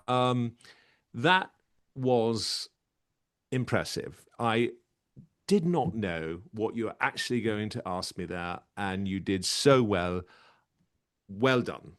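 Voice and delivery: deliberate talent-show judge, with long pauses; measured, self-assured judge impression; Simon Cowell. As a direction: give a verdict haltingly for suspense. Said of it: "wrap it up with Simon Cowell".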